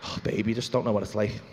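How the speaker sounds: very low, long-suffering celebrity; mock self-pity. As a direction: lament fame in a low voice with heavy pauses. Plays burdened celebrity to wife.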